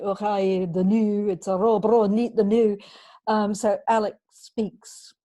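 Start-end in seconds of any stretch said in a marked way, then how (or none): 0.0-2.8 a hammed-up Scottish accent, a bit louder; mock Scottish accent. Author mocking overdone dialect in a book, hammed-up Scottish accent. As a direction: tease the Scots speech a character might overdo. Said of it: "go through the entire book going".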